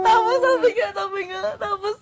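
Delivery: high squealing words through giddy laughter; can barely get it out for laughing; laugh-crying. Real, not acted. Giddy friend laughing while retelling what he said.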